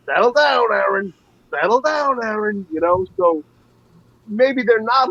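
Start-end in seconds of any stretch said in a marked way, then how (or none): 0.1-2.7 lightly voiced umpire, higher pitch; imitated umpire. Umpire to the arguing Aaron on the bench, lightly imitated warning. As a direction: raise the pitch slightly, as a quoted umpire. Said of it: "you heard the ump saying".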